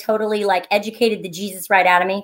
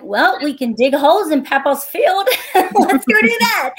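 slow, higher pitch of a parent selling an idea; imitating herself as mom; 6 semitones higher, slower than usual. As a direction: pitch it slowly to your kid, breaking into a laugh. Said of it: "And I'm like".

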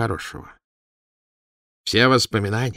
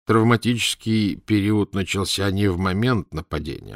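plain reading voice

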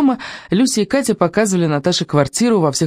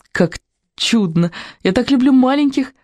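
thrilled outburst, much higher; delighted cry; 8 semitones higher than usual. A narrator's reading. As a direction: gush over little kids. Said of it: "вскричала Люся". (восторженный возглас)

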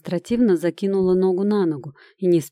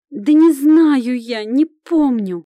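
sudden high, loud cry; nervous outburst; 7 semitones higher, 8 dB louder, slower than usual. Acted. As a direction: snap out nervously. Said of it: "вдруг нервно выкрикнула Катька".

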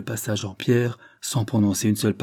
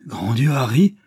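louder, higher exclamation; horrified cry; 4 semitones higher, 5 dB louder than usual. A narrator's reading. Mother aghast at her son's violence, horrified cry. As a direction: cry out in shock. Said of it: "s'écria Lady Dane".

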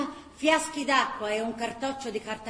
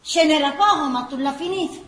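scolding; 4 semitones higher, 9 dB louder than usual. Acted. Mother takes the wine flask.

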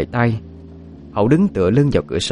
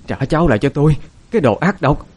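higher, louder furious screaming, building to a shriek; screaming in grief; 7 semitones higher, 4 dB louder, faster than usual. A narrator's reading. Grandmother blaming her daughter-in-law for the child's death, screaming. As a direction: hurl it at her like a curse, shrieking. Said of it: "Bà Lan gào thét".

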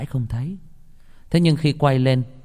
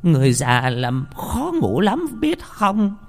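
cursing grandmother; 11 semitones higher than usual. Acted. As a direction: drag out the complaint in a high cranky voice.

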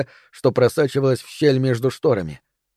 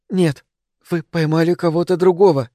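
crying; 4 semitones higher than usual. A narrator's reading. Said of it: "Она плакала".

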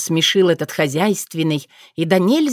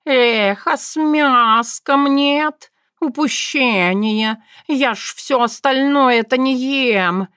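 higher, slow whiny cat voice; complaining cat; 6 semitones higher, much slower than usual. A narrator's reading. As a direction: grumble like a spoiled tomcat. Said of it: "пожаловался кот".

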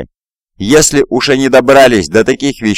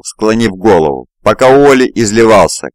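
ordinary delivery

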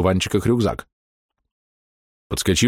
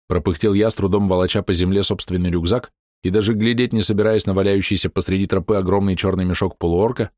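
plain reading voice

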